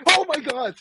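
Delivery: high-pitched mock shock; startled shriek, imitated. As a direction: squeal it like someone caught off guard. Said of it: "Karen literally jumped out of her shoes".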